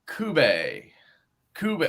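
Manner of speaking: Cube said as koo-BAY; Stevie's pronunciation. Retold or imitated. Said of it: "he calls it".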